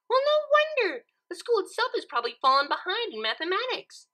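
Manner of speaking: Pinkie voice. A narrator's reading. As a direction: chirp it out like a cheerful pony.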